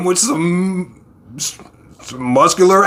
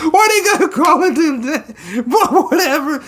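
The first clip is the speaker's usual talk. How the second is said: high, laughing shout; high laughing disbelief; laughing outburst; 11 semitones higher than usual. Real, not acted. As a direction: crack up in disbelief.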